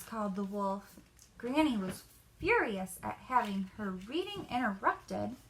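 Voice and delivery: ordinary delivery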